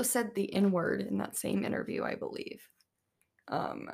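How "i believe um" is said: whispered aside. Real, not acted. Trailing aside.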